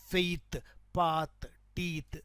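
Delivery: ఫెయిత్, పాత్, టీత్ each ending on a clear light th; final "th" shown. Shown as a demonstration. Teacher gives example words for TH, final th stressed. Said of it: "ఆ లాస్ట్లో వచ్చి ఇత్".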